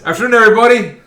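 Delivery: loud greeting. Acted. A character enters, greeting the room.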